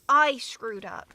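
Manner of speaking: slow, loud cry of self-blame; anguished outburst. Acted. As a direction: break out in despair. Confessing fatal mistake.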